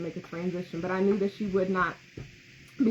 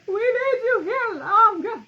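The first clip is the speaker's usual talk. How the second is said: high, loud tearful plea; pleading outburst; 11 semitones higher, 5 dB louder than usual. Retold or imitated. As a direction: beg her to stay, half-crying. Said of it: "And I'm like".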